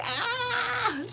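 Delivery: high, drawn-out squealed "ah"; mock wail. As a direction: let out a high mock cry of exasperation. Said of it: "it's just like".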